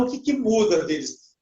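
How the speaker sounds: ordinary delivery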